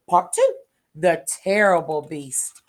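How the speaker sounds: ordinary delivery, nothing marked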